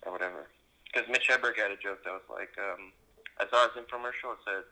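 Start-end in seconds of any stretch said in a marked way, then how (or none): none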